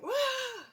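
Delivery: high-pitched mock scream, kept in check; held-back scream. Showing her scream without scaring the cat, restrained shriek. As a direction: imitate a scream without really letting it out. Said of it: "I can't scream right now".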